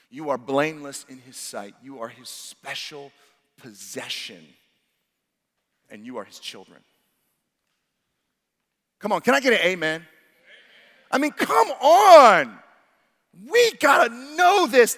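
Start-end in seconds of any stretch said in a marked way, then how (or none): 0.1-6.8 much softer and lower, intimate; hushed preaching. Real, not acted. Preacher telling listeners they are God's children, hushed. 11.1-15.0 higher, louder, slow pleading cry; fervent exhortation. Real, not acted. Preacher urging the church to grasp it, fervent.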